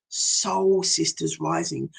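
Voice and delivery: a soft hushed echo of the programme name. Shown as a demonstration. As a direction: echo the title back, hushed.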